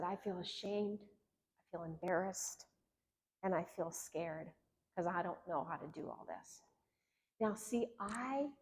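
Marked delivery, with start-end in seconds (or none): 0.0-6.6 soft, low retelling of shame; retold tearful confession. Husband confiding shame to his therapist, softly downcast. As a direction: quietly voice his confession, subdued. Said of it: "And he got very tearful".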